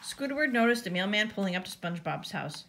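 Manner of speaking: plain reading voice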